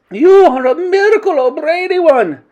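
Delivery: high, loud, rapturous praise; adoring cheer. A narrator's reading. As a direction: hail your leader like a thrilled witch. Witches cheer their leader.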